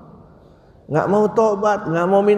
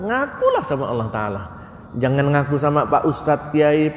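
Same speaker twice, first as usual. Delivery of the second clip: normal talking voice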